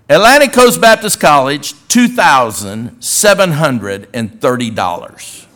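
loud, slowing, emphatic announcement; reading out check. Retold or imitated. Donor reading the check to Brother Miller, loud proclamation. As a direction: proclaim each word of the amount triumphantly. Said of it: "I'm gonna read this check to you".

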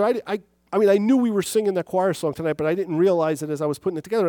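ordinary delivery

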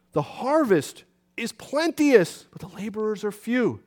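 drawn-out, emphatic verse; slow scripture quote. Real, not acted. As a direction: stretch each word of the verse.

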